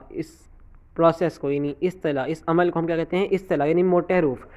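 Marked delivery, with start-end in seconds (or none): none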